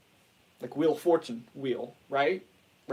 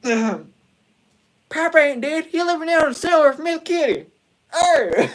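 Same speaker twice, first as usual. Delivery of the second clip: put-on much higher, louder drunken holler; Goonie Bird; 11 semitones higher, 10 dB louder than usual. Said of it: "Goonie Bird was just".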